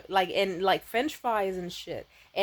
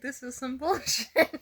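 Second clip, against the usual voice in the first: laughing; 4 semitones higher, slower than usual. Retold or imitated. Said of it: "couldn't stop himself from laughing".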